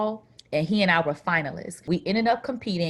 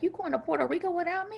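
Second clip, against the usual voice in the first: boyfriend's mock indignation; 11 semitones higher, 4 dB quieter, much faster than usual. Boyfriend angry she travels.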